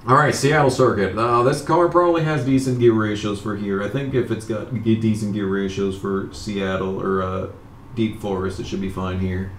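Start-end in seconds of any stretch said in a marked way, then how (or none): none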